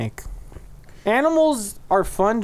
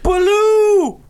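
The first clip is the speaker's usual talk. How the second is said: high squeaky cartoon bear cry; a goofy high bear call; 14 semitones higher, 5 dB louder than usual. Voicing the bear in story.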